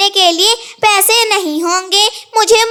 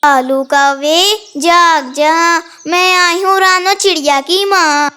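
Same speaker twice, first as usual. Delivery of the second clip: haunting mother's ghost, words stretched; pretend ghost; 2 semitones lower than usual. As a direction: call like a ghost come to haunt. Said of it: "फिर रानो चिड़िया कालू कौवे को डराने".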